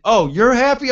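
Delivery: mock indignant retort through pain; pained anger. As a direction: imitate an angry partner, high and loud. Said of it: "with pain and anger in his eyes".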